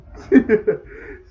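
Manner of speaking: sudden loud, high laugh; real amused laugh. Real, not acted. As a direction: burst out laughing. Amused by "she mad.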